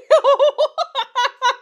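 high shrieking laugh fit. Real, not acted. Reader in a laughing fit at the fanfic. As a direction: let the laugh run high and long. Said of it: "I'm screaming".

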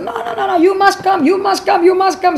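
high, pressing repeated plea; insistent. Retold or imitated. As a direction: insist without taking a breath for refusal. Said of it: "She very insistent".

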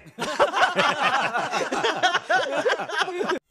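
long, very high cackling burst; high-pitched laugh. Real, not acted. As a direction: break into a shrill, pulsing laugh after losing. Reaction to being killed in game. (ऊँची आवाज़ में लंबी हँसी)

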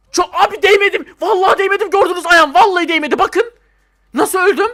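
high, loud outraged insisting; loud high indignant pleading; indignant protest. Real, not acted. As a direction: protest you never touched it. Protests unfair death in game.

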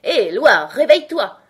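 loud call rising high; waking shout. A narrator's reading. Hatter and Hare waking the dozing Loir, shouting. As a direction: shout to rouse a sleeper. Calling to wake a sleeper.

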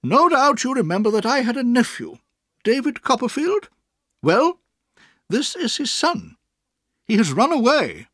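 put-on high, firm old aunt. A narrator's reading. Aunt addresses Mister Dick firmly. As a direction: settle him down with authority.

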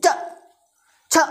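च and छ snapped out short with no vowel; teacher showing half letters, clipped; short jerky letters. Shown as a demonstration. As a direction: jerk out च, छ sharply. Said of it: "ऐसे झटके से बोलना है".

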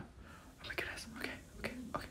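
hushed, frightened whisper; horrified whisper. Real, not acted. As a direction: whisper it under your breath, horrified. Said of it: "actually horrified right now".

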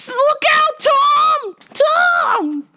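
put-on very high, drawn-out falsetto old lady; hammed-up fretful old woman; old lady moviegoer yelling. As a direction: squeal warnings at the movie screen. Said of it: "people were hearing them talking and yelling".

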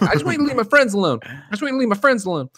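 mock Marty plea. Marty voiced in retold scene.

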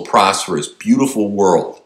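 ordinary delivery

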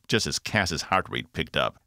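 usual speaking voice

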